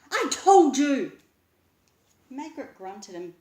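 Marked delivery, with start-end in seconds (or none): 0.1-1.2 louder, higher, drawn out; slow triumphant shout. A narrator's reading. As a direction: yell I told you. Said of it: "he said".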